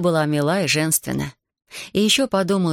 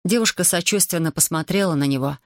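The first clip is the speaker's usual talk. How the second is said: ordinary delivery, nothing marked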